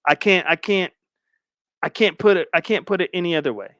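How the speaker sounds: normal talking voice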